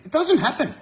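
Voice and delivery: high, loud, insistent denial; indignant high-pitched no; emphatic insistence. Real, not acted. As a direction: flatly refuse the idea. Insisting against a myth.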